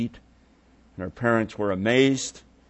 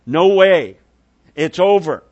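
much louder, higher desperate scream; 6 semitones higher, 10 dB louder than usual. Retold or imitated. Father hearing his daughter died, screaming denial. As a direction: let the denial break into a yell. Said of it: "Everything in him would scream".

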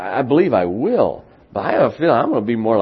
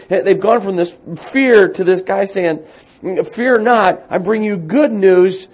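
much higher and louder, building excitement; preaching at full force; 11 semitones higher, 9 dB louder than usual. Acted.

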